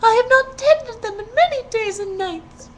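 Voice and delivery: high, loud alarmed maiden; crying out girl. A narrator's reading. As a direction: cry out in panic for the roses. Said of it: "the girl cried".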